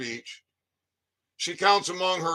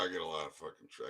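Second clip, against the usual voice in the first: muttered; 12 semitones lower, 18 dB quieter, much faster than usual. Real, not acted. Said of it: "yeah, I got triggered".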